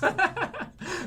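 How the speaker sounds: a sudden high squeaky laugh; high burst of laughter. Real, not acted. Vlogger cracking up at his own joke, high laugh.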